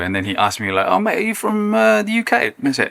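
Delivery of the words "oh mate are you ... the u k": put-on lightly done British accent, higher pitch; quick mock British accent, pitched up. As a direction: imitate a British paddle partner asking a question. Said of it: "I had the most British accent ever".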